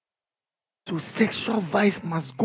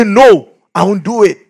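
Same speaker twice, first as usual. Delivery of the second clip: firm refusal; 3 semitones higher, 10 dB louder than usual. Shown as a demonstration. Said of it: "now you must say".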